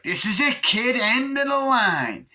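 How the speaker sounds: slow, high, loud villain taunt; menacing Chucky. Retold or imitated. Chucky taunting Tyler, slow loud threat. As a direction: stretch it out like a gloating killer doll.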